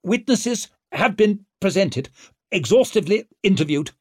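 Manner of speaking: strained, high-pitched announcement; struggling to be heard. A narrator's reading. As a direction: call out over an angry crowd. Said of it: "struggled to make himself heard over the anger".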